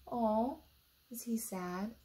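ordinary delivery

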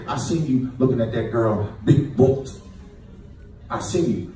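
low, soft accusing murmur; accusing girlfriend. Retold or imitated. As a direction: hold it over him quietly. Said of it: "My girl gonna say".

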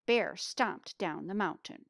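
plain reading voice

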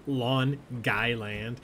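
slow Long Island drawl of "Long Guyland"; Long Island accent. Pretend. Host mocks the Long Island accent for trivia players. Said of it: "the correct answer is Long Island".

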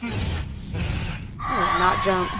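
slow, stretched defiant shout; drawn-out shout. Real, not acted. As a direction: drag out each word in a shout. Refusing game jump scare.

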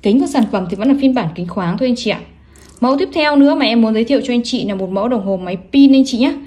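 ordinary talk, nothing marked

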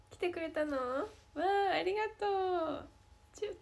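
bright, high, sugary thank-you ending in a kiss sound; cute fan service. Pretend. Playing the cute idol for a fan on stream, sugary and high. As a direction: gush like an idol greeting a fan who showed up. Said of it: "こんな感じです".

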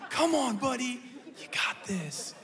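put-on hyping up a nervous kid, lifted high; dad cheering son on in a bathroom stall, pitched high and eager; encouraging dad. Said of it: "you know I'm an encourager".

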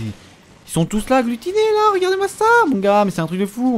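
very high astonished exclaim; amazed. Real, not acted. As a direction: gasp it out in disbelief. Stupéfait devant les ennemis. (stupéfait, voix qui monte très haut)

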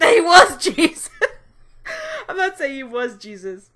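laughing through the words; real laughter, high-pitched. Real, not acted. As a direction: crack up mid-sentence. Laughing at own joke.

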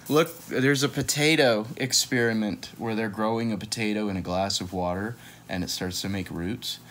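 ordinary delivery, nothing marked

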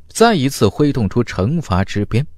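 usual speaking voice

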